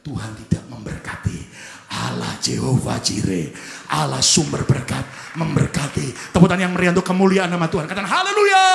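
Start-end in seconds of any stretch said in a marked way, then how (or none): 1.9-8.7 loud proclamation building to a shout; full-force preaching. Real, not acted. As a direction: rouse the congregation to applause. Preacher rousing the congregation.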